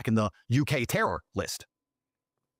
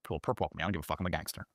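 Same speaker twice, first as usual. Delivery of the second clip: cool defiance; 7 semitones lower, 7 dB quieter than usual. Retold or imitated. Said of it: "I was like".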